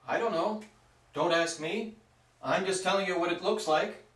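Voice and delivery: ordinary talk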